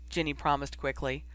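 ordinary delivery, nothing marked

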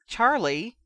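sharp scolding cry of a name; a mother's loud rebuke; scolding shout. A narrator's reading. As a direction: call him out, loud and high.

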